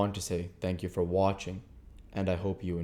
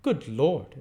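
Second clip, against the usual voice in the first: man voiced; 7 semitones higher, 6 dB louder than usual. Matthew quoted by Camilla.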